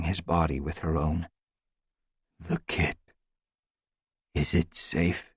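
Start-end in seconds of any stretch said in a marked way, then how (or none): 2.4-5.3 weak rasping voice. Acted. Firestar asking about the kit, weak rasping. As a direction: rasp it out, barely voiced. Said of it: "her father's rasping mew".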